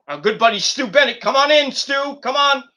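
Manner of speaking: loud, high call to a guest. Acted. Host calling his guest onto the show, loud and high.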